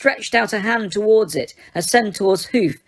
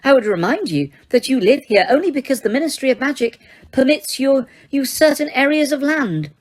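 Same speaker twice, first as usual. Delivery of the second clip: much higher, haughty and shaken; high indignant Umbridge; 7 semitones higher than usual. A narrator's reading. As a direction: lecture them while trembling. Umbridge's voice held on.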